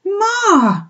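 high, loud cry of recognition; breathless cry. A narrator's reading. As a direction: call out to Mum in a rush. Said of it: "he cried breathlessly".

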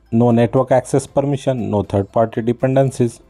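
ordinary talk, nothing marked

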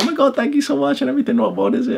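squealing high thank-you voice; excited girls. Retold or imitated. Retelling how the airport girls thanked him, squealed high. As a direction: mimic the delighted airport girls, high and bright.

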